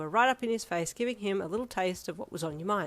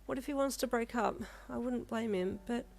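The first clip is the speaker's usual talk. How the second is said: anxious inner worry, higher and softer; panicked thoughts; 4 semitones higher, 4 dB quieter than usual. A narrator's reading. Yin panicking alone that she ruined things, anxious. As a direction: fret quietly to yourself in a panic. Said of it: "you thought in a panic".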